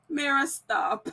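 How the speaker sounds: giggling while telling herself to stop; can't stop laughing at yourself. Real, not acted. Laughing at her own ranting.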